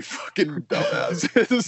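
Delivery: can't hold the cop's line together for laughing; laughing through it. Retold or imitated. Teller quotes the cop to friends, laughing through the insult.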